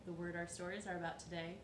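ordinary delivery, nothing marked

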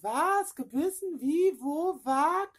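high, loud alarmed burst of questions; mother hearing her daughter was bitten, shocked and high. Retold or imitated. Hears her child was bitten.

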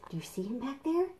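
put-on conspiratorial whisper to the kids. Reader pointing out the hiding dinosaur, whispered aside. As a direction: let them in on a secret, softly.

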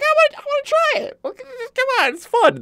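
high, pleading, whiny voice of herself begging to play; squeaky high whine, stumbling on come on; mock whiny self. Pleading with angry teammates in a high whine. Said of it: "And it's like".

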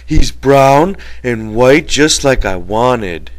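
breathless delight, loud and drawn out. A narrator's reading. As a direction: gush slowly about the new pup. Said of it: "Lenny said breathlessly".

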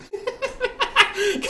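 high burst of laughter; high giggling burst. Real, not acted. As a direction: laugh at his own silly name. Laughing at his own joke.

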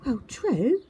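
high, puzzled and innocent; confused little owl voice. A narrator's reading. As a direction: stammer it in wonder. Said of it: "Twit said".

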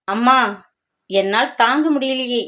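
usual speaking voice

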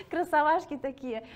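laughing, high and loud. Real, not acted. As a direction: burst out laughing at the cute picture. Laughing at a cute photo. (смеясь, высоко и громко)

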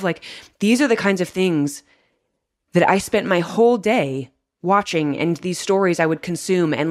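ordinary talk

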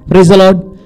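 normal talking voice

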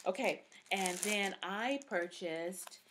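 ordinary talk, nothing marked

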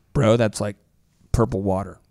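mock a watery drink, unimpressed; unimpressed friend. Friend tasting over-diluted Kool-Aid, deadpan gripe. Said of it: "you're eventually like".

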